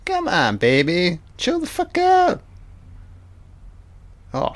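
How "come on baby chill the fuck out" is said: higher, soothing then squeaky plea; voicing A J. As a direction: rise high as he calms Snow. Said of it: "I'm A J".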